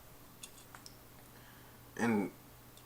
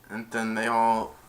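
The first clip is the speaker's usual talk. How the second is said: ordinary talk, nothing marked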